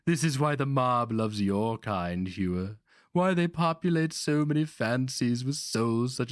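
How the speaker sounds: put-on high, needling voice of a scheming old master; sly old sorcerer. A narrator's reading. Old Grandmaster needling his captive barbarian, high and sly. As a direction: tease the captive with silky menace.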